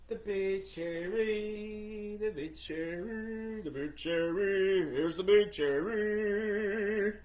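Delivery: slow, stretched-out tease of the big cherry; drawn-out teasing reveal. Pretend. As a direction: stall before the punchline, slowly. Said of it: "Here it comes".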